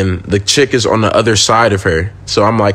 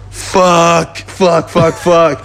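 panicked swearing; 5 semitones higher, much slower than usual. Retold or imitated. Panicking when she stares at him.

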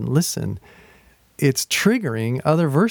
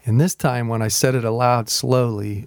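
normal talking voice

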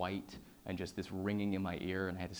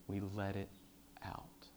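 slow, softer, ending in a whisper; fading to a whisper; 8 dB quieter, slower than usual. Real, not acted. Speaker urging the audience, fading whisper. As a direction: let the last word drop to a breath.

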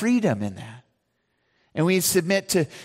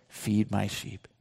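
slow, low and much softer; gentle command; 7 semitones lower, 8 dB quieter, slower than usual. Acted. Jesus quietly recommissioning Peter, slow and tender. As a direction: lay the words down slowly and tenderly.

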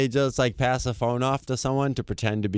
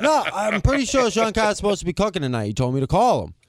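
prank caller; 9 semitones higher than usual. Retold or imitated. Caller part in prank story.

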